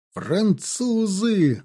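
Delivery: slow high alarmed cry; shout of alarm. A narrator's reading. Soldiers in the line warning of the enemy, alarmed cry. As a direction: sound the alarm, drawn out. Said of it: "раздались крики в цепи".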